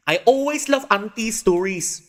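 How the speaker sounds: put-on high, loud childish voice; kid character voice. Kid gushing about auntie's stories in a high, loud voice.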